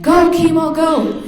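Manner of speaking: high, loud drawn-out cheers; sideline cheering yell. Retold or imitated. Mother running the sideline at son's football, re-enacted screaming cheer. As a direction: holler your child down the field. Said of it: "screaming out his name".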